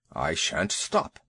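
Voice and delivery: a big worried beast demanding answers; low slow elephant voice; lightly voiced elephant. Elephant Two Tails voiced.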